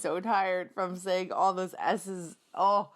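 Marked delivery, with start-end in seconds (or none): none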